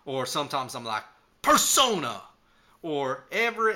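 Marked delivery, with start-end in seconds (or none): 1.4-2.2 loud, high catchphrase cry; transformation shout. Retold or imitated. Cowboy putting on his hat, shouting his power-up. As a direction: call it out as a power-up. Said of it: "and I shout".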